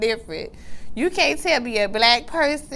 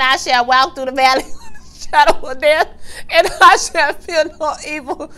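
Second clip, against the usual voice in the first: higher, louder scripture reading through laughter; mock preacher; 6 semitones higher, 4 dB louder than usual. Waterford quoting scripture to Serena, in a hammed-up, laughing preacher voice. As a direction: ham up the Bible quote, laughing. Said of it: "he is quoting".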